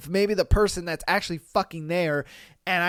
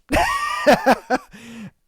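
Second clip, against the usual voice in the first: loud laugh; 8 semitones higher, 5 dB louder than usual. Real, not acted. Laughs at the cheater's reply.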